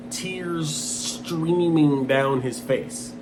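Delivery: ordinary delivery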